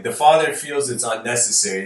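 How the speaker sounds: ordinary delivery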